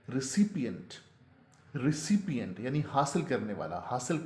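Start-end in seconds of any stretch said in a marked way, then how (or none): 0.1-2.6 रिसिपिएंट as ri-SIP-i-ent; correct pronunciation. Shown as a demonstration. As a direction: sound every syllable of रिसिपिएंट, stressing "सिप". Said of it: "असल में जो इसका तलफ़ुज़ है". (रिसिपिएंट, "सिपि" साफ़ बोलते हुए)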